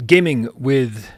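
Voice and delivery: slow announcement with pauses; slow, deliberate read. Real, not acted. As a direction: draw out each part of the name. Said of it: "I have to read that slow".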